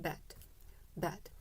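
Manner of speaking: bet said soft and relaxed; short e in bet. Shown as a demonstration. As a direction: let bet out without force. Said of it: "힘을 빼고 bet".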